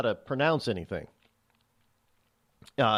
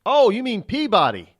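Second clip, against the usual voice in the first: loud, high "oh" of recognition; sudden realisation; 8 semitones higher, 9 dB louder than usual. Retold or imitated. Outsider finally recognising the town name, sudden realisation. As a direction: burst out as the name finally clicks. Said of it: "We don't, Peabody".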